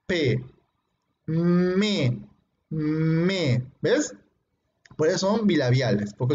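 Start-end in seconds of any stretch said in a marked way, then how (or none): 0.1-3.7 P and me, lips together; bilabials, said slowly; demonstrating P and M. Shown as a demonstration. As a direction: show the bilabial sounds. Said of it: "igual a P".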